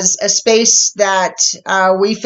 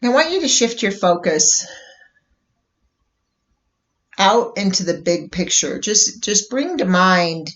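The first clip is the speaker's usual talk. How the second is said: guided meditation. Real, not acted. Host leading listeners into a bubble meditation, calm guided meditation. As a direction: ease them into stillness, unhurried, letting each phrase settle.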